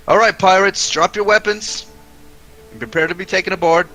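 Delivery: loud, high soldier calling down; gruff soldier shout. Acted. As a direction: call down from the deck gruffly. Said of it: "a gruff voice calling from one of the decks".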